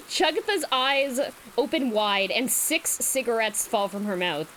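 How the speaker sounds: ordinary delivery, nothing marked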